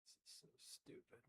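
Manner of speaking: breathy whispered aside to herself. Real, not acted. Vlogger mutters to herself about her own silliness, whispered. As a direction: whisper it, half embarrassed.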